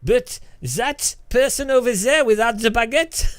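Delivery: high, loud mock French cop; hammed-up French gendarme pointing him out; mock French cop spotting the Englishman; French policeman.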